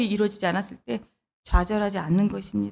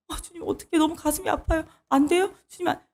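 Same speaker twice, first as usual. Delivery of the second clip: higher, mild imitated fretting; a touch of a helpless plea to the Lord; flustered pleading; 6 semitones higher, 5 dB quieter than usual. Believer fretting before the Lord, mildly imitated whine. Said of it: "주님께 쩔쩔쩔 매거나".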